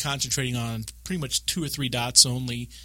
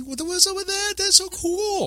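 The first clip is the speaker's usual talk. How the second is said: very high, squeaky amazement; hammed-up gushing excitement; mock awe. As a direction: play a wide-eyed newbie, pitched way up. Acts out awe at boss attack.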